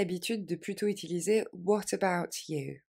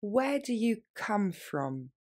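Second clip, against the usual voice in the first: said slowly and clearly; slow it right down; slower; 2 semitones higher, slower than usual. Shown as a demonstration. Teacher repeating the question more slowly. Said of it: "plus lentement".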